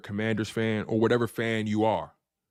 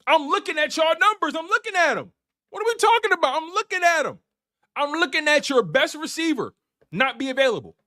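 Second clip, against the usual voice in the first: high, louder fired-up taunt; hyped taunting; 4 dB louder than usual. Real, not acted. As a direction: rile the rival fans up. Hyped taunting of rival fans.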